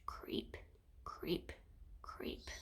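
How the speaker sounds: barely voiced creep, creep, creep; whispered creeping. A narrator's reading. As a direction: tiptoe each creep in a whisper. Sneaking baddies, whispered creeping.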